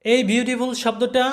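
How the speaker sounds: usual speaking voice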